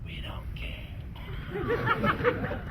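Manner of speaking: slow drawn-out eerie whisper; creepy whisper. Acted. Skunks hissing back at Jason, slow eerie whisper. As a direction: breathe it out like a ghost. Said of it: "skunks reply".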